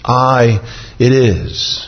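very slow, with "I" held long; emphatic, drawn-out "I"; stress on I. Acted. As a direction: stretch "I" out and set it firmly apart.

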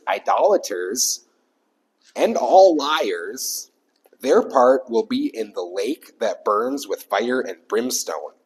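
loud, slow, weighty reading of doom; forceful scripture reading. Acted. Pastor reads hell verse to congregation, loud and slow. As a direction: thunder the verse out slowly.

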